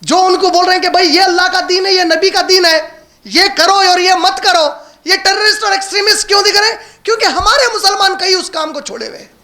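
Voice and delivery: high, insistent sermon voice; impassioned preaching. Acted. Preacher asks why true teachers look like extremists, impassioned. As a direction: press the indignant question on the congregation.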